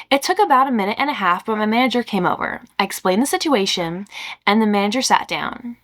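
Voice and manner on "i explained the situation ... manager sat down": ordinary delivery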